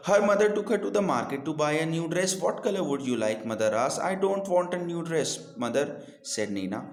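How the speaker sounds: put-on slow, clear reading to children; slow deliberate read-aloud for young learners; storybook reading. A narrator's reading. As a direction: read the story slowly and carefully. Reads English story aloud.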